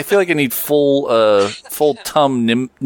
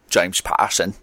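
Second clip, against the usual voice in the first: Michael Caine accent; 6 semitones lower, 4 dB quieter than usual. Shown as a demonstration. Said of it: "I'm just gonna do Michael Caine".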